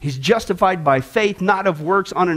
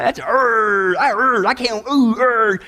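salivating craving; 4 semitones higher, slower than usual. Shown as a demonstration. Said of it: "a salivating effect".

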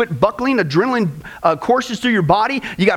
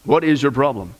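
low, slow, unbothered heavenly voice; reply coolly and deep, like a game show host; calm voice; 6 semitones lower than usual. Retold or imitated. Voice from above answers the panicking tourist, calm and low. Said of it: "the calm voice replied".

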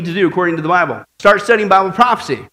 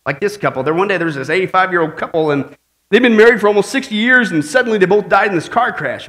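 normal talking voice

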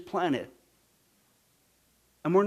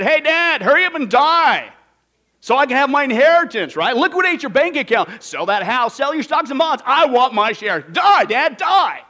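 loud, brash greedy son; louder mocking entitled son; demanding son; 3 semitones higher, 8 dB louder than usual. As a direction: bark it like a spoiled son wanting his money. Acted greedy son demanding inheritance.

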